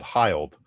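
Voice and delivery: "Hild" said to rhyme with wild; long-i mispronunciation. Shown as a demonstration. Quoting how callers get the surname wrong, long-i mispronunciation. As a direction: give "Hild" a long i, like "hiled". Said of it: "it rhymes with wild or mild".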